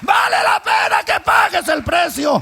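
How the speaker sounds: loud, high preaching at full force; fiery preaching. Acted. Preacher shouting the story of the cross to the church. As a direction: shout it to the congregation.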